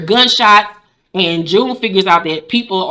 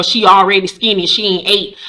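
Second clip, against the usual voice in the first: normal talking voice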